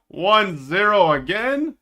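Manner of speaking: ordinary talk, nothing marked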